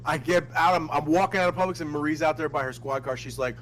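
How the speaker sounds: ordinary talk, nothing marked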